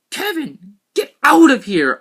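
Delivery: high yelled cry at his brother; high shout. Acted. Boy yelling at his brother to get out. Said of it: "I cried".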